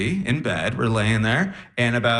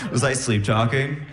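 ordinary talk

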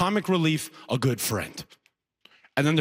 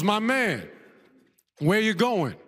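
higher-pitched, confident buddy voice; confident first guy; 6 semitones higher, slower than usual. Acted. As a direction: play the reliable, friendly guy, pitched up. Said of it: "the first guy".

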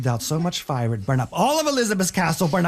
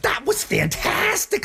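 very high, gushing praise; delighted princess; 13 semitones higher than usual. Acted. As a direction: squeal it out to flatter. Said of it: "says Elizabeth".

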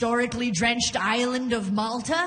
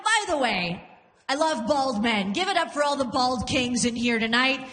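ordinary delivery

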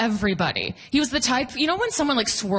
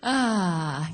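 ordinary talk

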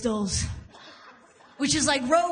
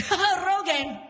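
mock higher outburst, barely held in; trying to contain himself; 7 semitones higher than usual. Dad reacts to his Rogaine gift, mock restraint slipping. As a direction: imitate Dad struggling to hold it in. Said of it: "trying to hold it all in".

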